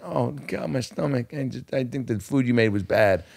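high, soft hammy pained voice; badly acted stomach ache. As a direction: fake being sick, badly. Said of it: "just really poorly, you know, delivering".

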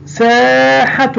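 ساحة slow with a long aa; stretched saa. Shown as a demonstration. Teacher drawing out ساحة for the pupils. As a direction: hold the aa in ساحة. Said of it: "المد سا".

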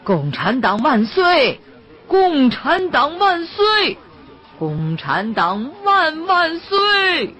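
slow, high rising shouted slogans; a doomed man shouting slogans at his own execution, drawn-out and defiant; loud slogan shout. Acted. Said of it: "大声地吆喝".

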